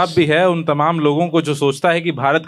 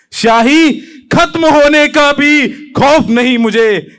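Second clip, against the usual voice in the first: higher, ringing repeat of a verse; heightened poem recitation; 9 semitones higher, 6 dB louder, slower than usual. Acted. Poet vowing he has no fear, intensified. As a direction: lift the pitch and proclaim it.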